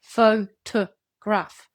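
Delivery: photograph syllable by syllable, middle one a weak schwa; slow, separated syllables in photograph, with "to" reduced to "tuh"; reduced vowels. Shown as a demonstration. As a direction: break photograph into PHO-tuh-graph, very slowly. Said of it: "They don't keep strong vowel sounds".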